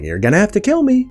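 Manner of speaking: quick, high, defiant voice for Sylvie; mock Sylvie defiance. Sylvie refusing to back down to Loki, high mock defiance.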